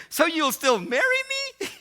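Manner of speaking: high, loud mock-female plea; imitated hopeful woman. Fiancée checking he forgives her, high hopeful imitation. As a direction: squeak the question as her.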